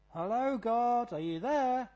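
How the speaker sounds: high, slow, singsong hello; mock polite call. Shown as a demonstration. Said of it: "it's not this polite".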